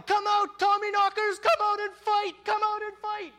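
full, high-pitched yell; kids' battle cry, very loud and high; yelling challenge. Retold or imitated. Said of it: "they start yelling".

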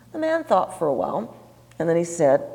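ordinary talk

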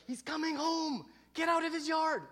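high, loud urgent shout; acted-out alarmed kid; panicked warning. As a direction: warn friends to flee at once.

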